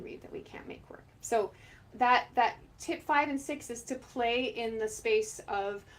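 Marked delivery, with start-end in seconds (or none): 0.0-1.0 whispered, half laughing; a conspiratorial whisper to students; whispered aside. Real, not acted. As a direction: lean in and whisper it.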